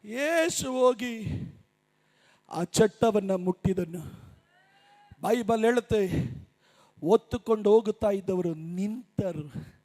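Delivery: very low, soft preacher's voice with long pauses; hushed preaching. Real, not acted. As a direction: drop deep and quiet, letting each phrase hang. Preacher drops to hushed telling. (ತಗ್ಗಿದ ಗಂಭೀರ ದನಿಯ ಬೋಧನೆ)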